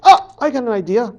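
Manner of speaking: high, loud, quick burst; light up with a sudden idea; sudden inspiration. Acted. Coyote suddenly gets an idea.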